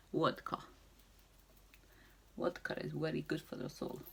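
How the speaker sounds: hammed-up, badly faked Russian accent. Vlogger joking about vodka for the soul, terrible Russian accent. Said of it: "the worst Russian accent".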